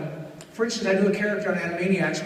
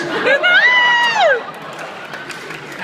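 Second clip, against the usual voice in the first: high squeaky cackle; 7 dB louder than usual. Acted. Laugh in Buttram voice.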